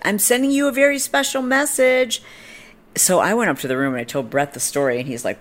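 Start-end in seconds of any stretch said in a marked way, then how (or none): 0.1-2.2 very high, sing-out promise; high-pitched mock parting call; imitating calling woman. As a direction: mimic her calling after someone, pitched way up. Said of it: "she's like".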